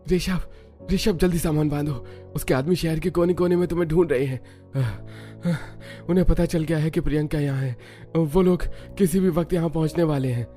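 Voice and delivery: urgent, panting between phrases; breathless warning. Acted. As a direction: gasp out the danger after running home. Runs home with urgent warning. (हाँफते हुए घबराई चेतावनी)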